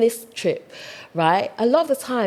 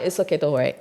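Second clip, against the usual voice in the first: giving up, soothing; 7 semitones lower, faster than usual. Retold or imitated. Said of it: "sometimes I'm like".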